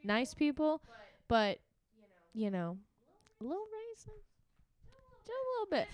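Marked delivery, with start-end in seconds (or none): 3.4-5.9 coy understatement. Real, not acted. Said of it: "coy understatement".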